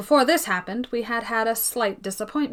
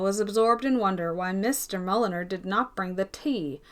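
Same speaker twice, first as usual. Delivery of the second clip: ordinary delivery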